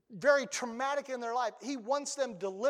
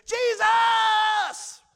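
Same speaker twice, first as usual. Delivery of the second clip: mock shout from the pews; 12 semitones higher, 13 dB louder than usual. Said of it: "somebody in the congregation".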